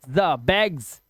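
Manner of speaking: isolated "za", then बैग्स ending in z; slow demo of the z in बैग्स; Z sound shown. Shown as a demonstration. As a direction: buzz the z alone, then slowly बैग्स with that z. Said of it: "क्या पढ़ते हैं".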